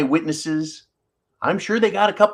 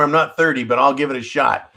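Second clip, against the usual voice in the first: ordinary talk, nothing marked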